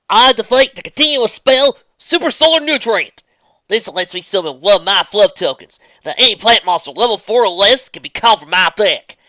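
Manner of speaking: put-on country girl calling her move; Southern accent. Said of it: "doing a Southern voice for a duel".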